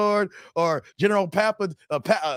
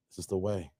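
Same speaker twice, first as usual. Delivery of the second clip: low, soft Mandalorian-style intone; deep hushed catchphrase; 9 semitones lower, 12 dB quieter than usual. Acted. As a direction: drop the voice low and solemn for the catchphrase.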